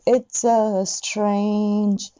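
slow It's a strange; ゆっくり; slow demo. Shown as a demonstration. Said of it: "strangeはストレンジじゃなくて".

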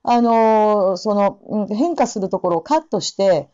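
usual speaking voice

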